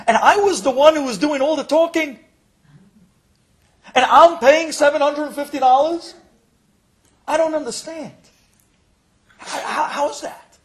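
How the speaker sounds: high, loud, indignant protest; worked up. Acted. Patient protesting the fee to the receptionist, worked up. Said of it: "he started to get all worked up".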